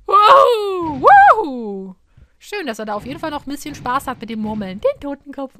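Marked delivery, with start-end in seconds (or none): 0.1-1.9 loud excited whooping. Real, not acted. Cheering at the game.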